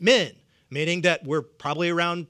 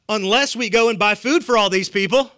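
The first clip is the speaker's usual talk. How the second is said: louder, higher, pointed protest; disbelieving tone; 4 semitones higher, 6 dB louder than usual. Acted. Disciples pointing out an impossible request, raised incredulous tone. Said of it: "do you hear the tone?".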